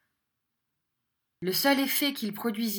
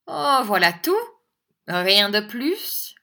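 loud, raised mock surprise; 3 semitones higher, 7 dB louder, slower than usual. A narrator's reading. Schoolmaster jeering at a plea for mercy, mocking. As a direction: scoff at the plea, slowing at the end.

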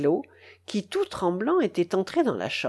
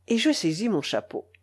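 ordinary delivery, nothing marked

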